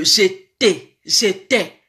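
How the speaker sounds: "j'étais" said with two clear é sounds; low demonstration of "j'étais", ending on a clear -tay; correct pronunciation. Shown as a demonstration. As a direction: model "j'étais" slowly as zhay-TAY, low-pitched. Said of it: "baloba j'étais".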